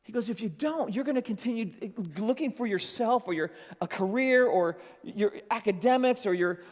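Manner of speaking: loud, high, impassioned pulpit voice; a fiery sermon crescendo to the congregation; full-force preaching. Acted. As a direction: preach it out, rising and urgent.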